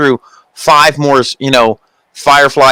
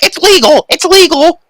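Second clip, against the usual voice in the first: high-pitched, excited commentator cry; mock a commentator shouting in protest; Michael Cole; 14 semitones higher than usual. Co-host mimics commentator defending the kick, high excited cry. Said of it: "Michael Cole's like".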